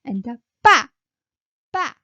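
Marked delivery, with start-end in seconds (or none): 0.6-0.9 빠 tense and loud, pitched high; 빠 snapped out high and forceful. Shown as a demonstration. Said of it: "high pitch".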